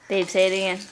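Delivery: ordinary delivery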